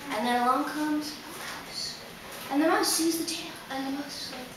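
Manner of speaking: soft, low storytelling that creeps along; hushed suspense. A narrator's reading. Storyteller as the mouse creeps toward the cat's tail, hushed suspense. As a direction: draw listeners in with a hush.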